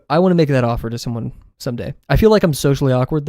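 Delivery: ordinary delivery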